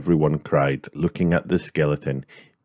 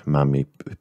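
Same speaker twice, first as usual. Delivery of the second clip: ordinary delivery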